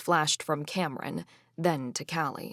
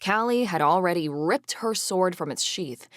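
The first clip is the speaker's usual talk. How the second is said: plain reading voice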